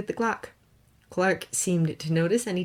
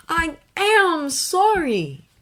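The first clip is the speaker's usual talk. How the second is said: higher, slow choked apology; Clark, choked up; 12 semitones higher, 6 dB louder, much slower than usual. A narrator's reading. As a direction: force it out through a tight throat. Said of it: "he choked out".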